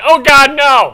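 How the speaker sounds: high, full-volume mock dismay; Brad's outburst. Host re-enacts crewmate reacting to a joke, loud high cry. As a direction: cry out in exaggerated horror. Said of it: "I think it was like".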